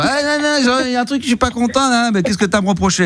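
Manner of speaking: high, whiny complaining voice; mock annoyed wife. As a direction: play the nagging spouse, high and petulant. Mimics the wife's complaints.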